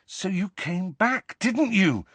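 high, pressing question; urgent. A narrator's reading. Harry pushes Nick about coming back, urgent. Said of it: "said Harry urgently".

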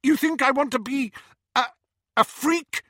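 high, loud, halting disgust; stall, then fling out the insult; scornful outburst. A narrator's reading. Petunia insults her sister.

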